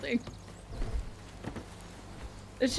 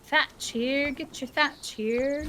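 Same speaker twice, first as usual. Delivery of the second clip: drawn-out, louder street vendor call; market hawker; 5 semitones higher, 3 dB louder, much slower than usual. Pretend. Player hawks thatch to friends like a vendor. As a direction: sell thatch like a market crier.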